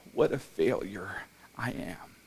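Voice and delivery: hushed, despairing whisper; a broken man whispering to himself; whispered self-reproach. Retold or imitated. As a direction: breathe it out as a private confession. Retold despairing inner thought.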